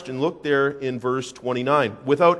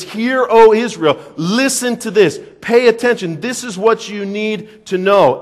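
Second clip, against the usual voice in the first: proclaiming call; 5 semitones higher, 5 dB louder than usual. Acted. Paraphrases Shema as a call.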